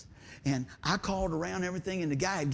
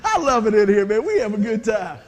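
excited; 2 semitones higher, 11 dB louder than usual. Real, not acted. Warm aside to congregation.

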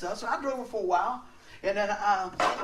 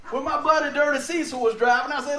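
ordinary delivery

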